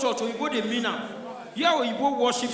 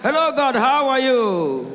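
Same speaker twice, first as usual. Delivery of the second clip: louder, higher, drawn-out hello; excited greeting; 4 semitones higher, 7 dB louder, slower than usual. Retold or imitated. Igbo man greeting God loudly before his friend, showing off. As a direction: show off talking to God. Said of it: "God just call me now".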